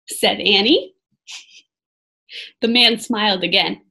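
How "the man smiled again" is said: ordinary delivery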